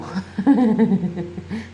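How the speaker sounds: spontaneous laughter; amused laugh. Real, not acted. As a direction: chuckle running on. Laughs at the card's answer.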